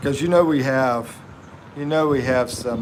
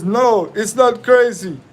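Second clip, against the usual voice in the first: reading viewer line; 8 semitones higher, 4 dB louder than usual. Retold or imitated. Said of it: "Hitler says".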